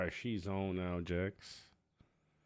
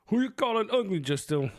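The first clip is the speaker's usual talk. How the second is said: high-pitched, loud mock outrage; mock indignation; 13 semitones higher, 6 dB louder than usual. Streamer jokingly challenging viewer Justin, mock indignant. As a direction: act offended, voice leaping up high. Mock offence at being called ugly.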